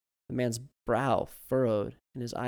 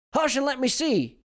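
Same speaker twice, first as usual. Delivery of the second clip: put-on high, loud hushing old man; 14 semitones higher, 9 dB louder than usual. A narrator's reading. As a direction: shush like a grandpa.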